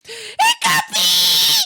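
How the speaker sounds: a long, stretched, high, loud call of the name; excited greeting. Real, not acted. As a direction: greet the viewer with a drawn-out squeal. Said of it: "I got a little excited".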